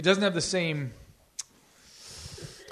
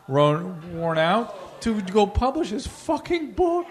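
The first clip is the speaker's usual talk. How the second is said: usual speaking voice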